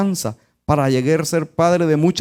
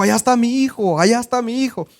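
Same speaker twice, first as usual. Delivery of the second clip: father calling out; 6 semitones higher, faster than usual. Retold or imitated.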